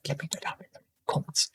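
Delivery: fast whispered aside. Real, not acted. Trader tells viewers about the comments, quick whisper. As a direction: hush it quickly as a side note.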